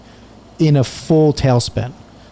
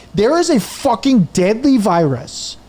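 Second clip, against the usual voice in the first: higher and slower, spitting out each word in outrage; retold furious rant; 7 semitones higher, slower than usual. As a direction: drag out the outrage at the rep. Retold rant at phone rep.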